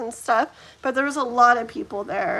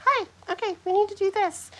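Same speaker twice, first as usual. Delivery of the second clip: high, tiny boss voice; put-on high little voice; little bitty guy; 7 semitones higher, faster than usual. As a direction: play a little guy giving orders in a high voice. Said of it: "this little bitty guy saying".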